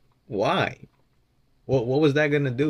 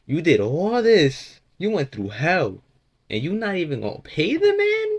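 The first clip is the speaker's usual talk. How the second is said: slow indignant; 6 semitones higher than usual. Real, not acted. Outraged on friend's behalf.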